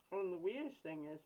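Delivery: ordinary talk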